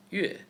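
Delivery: yue falling sharply; fourth tone. Shown as a demonstration. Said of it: "For tones".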